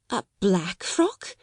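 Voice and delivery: slow, hesitant question; falter, baffled, lifting at the end; stammering. A narrator's reading. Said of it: "Miss Amelia stammered again".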